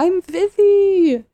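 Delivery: very high, slow, loud sweet voice; drawn-out high greeting; very high slow sing-song intro; imitating Vivi.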